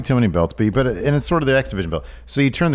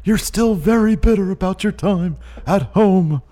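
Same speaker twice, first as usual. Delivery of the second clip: put-on higher voice for a wrestling partner; high placating character voice; 8 semitones higher than usual. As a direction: humour him in a high mock voice. Plays Keith Lee answering Luke.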